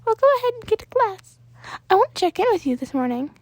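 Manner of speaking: high, bright little principal voice; a cheerful small creature greeting a student; high Nezu voice. Acted. Principal Nezu welcomes Danny into his office, in a high cheerful voice.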